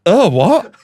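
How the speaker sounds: a loud bewildered "what?"; shocked. Retold or imitated. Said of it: "And I went".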